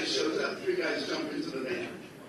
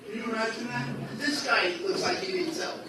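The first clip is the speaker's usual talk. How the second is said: ordinary delivery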